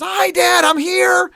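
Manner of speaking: high, loud, overeager hello; eager fake greeting. Jacob fooling his blind father, high and overeager. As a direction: chirp it up high like a nervous kid.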